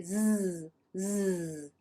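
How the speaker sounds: "z" held as a long buzz; voiced z. Shown as a demonstration. Teacher contrasting sounds for Spanish speakers, voiced "z". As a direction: hold "z" with the vocal cords vibrating. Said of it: "I'm using my vocal cords".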